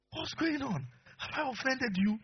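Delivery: high, slow worried questions; high-pitched anxious husband voice; bewildered husband. Retold or imitated. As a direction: ask like a baffled husband. Husband questions crying wife.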